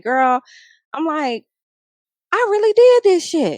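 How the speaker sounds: giddy pep talk to yourself; pumped self-talk. Retold or imitated. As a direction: cheer yourself on, way up high.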